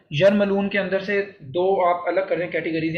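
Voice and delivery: normal talking voice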